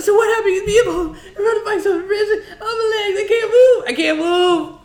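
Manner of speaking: very high mock wailing; imitating screaming, crying. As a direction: wail through a laughing retelling of agony. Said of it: "He was screaming, crying".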